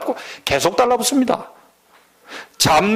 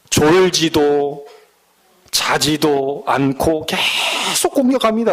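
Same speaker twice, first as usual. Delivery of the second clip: slow emphatic warning; 2 semitones lower, slower than usual. Real, not acted. Preacher slowing for emphasis.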